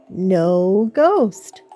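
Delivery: ordinary delivery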